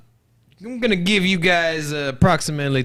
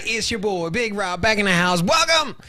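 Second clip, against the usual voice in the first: normal talking voice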